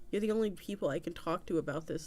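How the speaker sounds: plain reading voice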